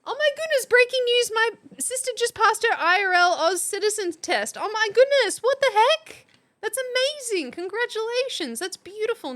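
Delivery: high and bright with real excitement; excited. Real, not acted. Streamer celebrating a viewer's sister passing citizenship test, excited. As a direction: cheer the good news for a viewer. Reading chat news of citizenship.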